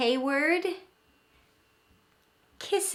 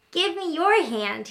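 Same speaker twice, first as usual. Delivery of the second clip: put-on lively child; 6 semitones higher, 4 dB louder than usual. A narrator's reading. Child asks mother.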